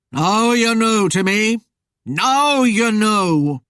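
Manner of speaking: ominous spectre. A narrator's reading. Rider reveals himself to his victim, ominous. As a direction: stretch it out into a haunting, slowing drone.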